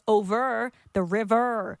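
"over the river" slowly, with hard R's sounded; rhotic R's. Shown as a demonstration. Co-host showing how Americans and Irish speak, heavy R's. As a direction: draw out the R ending "over" and "river". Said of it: "Americans and Irish pronounce their R's every time".

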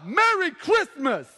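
high, loud, slow holler; over-eager greeting. Retold or imitated. Preacher greeting shoppers at Best Buy, loud drawn-out holler. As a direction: bellow a drawn-out greeting across a store.

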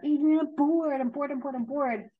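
high, whiny mock complaint; imitated bored child. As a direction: whine like a bored kid.

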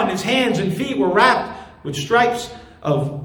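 ordinary talk

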